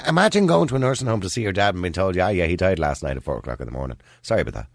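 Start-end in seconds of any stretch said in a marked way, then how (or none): 2.2-4.7 flat, low and offhand, like staff breaking bad news carelessly; callous staff voice. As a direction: say it lower, shrugging it off. Imagined nursing home staff telling news.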